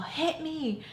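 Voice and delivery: high, drawn-out mock yell, held back in volume; retold demand. Pushing friend demands a hit, mock yell. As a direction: imitate a friend yelling without full volume.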